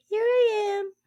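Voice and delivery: put-on high, eerie little girl voice, drawn out thin; creepy little girl. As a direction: play a spooky child stepping out of a cave. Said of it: "Imagine a creepy little girl's voice like".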